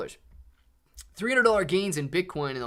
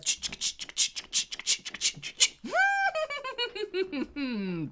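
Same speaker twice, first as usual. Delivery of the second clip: long, breathy, much higher giggle. Real, not acted. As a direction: giggle at the joke. Laughing at the unicorn emote.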